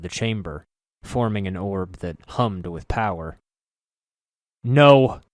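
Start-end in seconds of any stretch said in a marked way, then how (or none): 4.6-5.2 loud, high desperate shout; hero's desperate no; cry out. Acted. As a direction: cry out as the sphere bursts. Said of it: "I cried".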